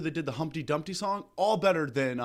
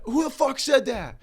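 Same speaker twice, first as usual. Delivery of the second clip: high, loud angry shout; terrorist yell; 12 semitones higher, 7 dB louder than usual. Retold or imitated. Terrorists shouting at the hidden hero, high and furious. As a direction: bark it out like a startled hijacker. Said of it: "And they're like".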